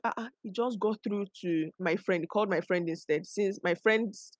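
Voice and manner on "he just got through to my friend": ordinary talk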